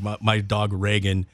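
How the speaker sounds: ordinary delivery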